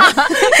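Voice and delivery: short, high, loud laugh; amused laugh. Real, not acted. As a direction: laugh out at the absurdity. Listener laughs at teacher's advice. (高声短笑)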